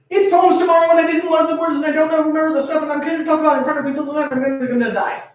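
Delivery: high and very fast babble; panicked child rambling. Acted. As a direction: rush it like a panicking kid. Panicking seven-year-old rambles.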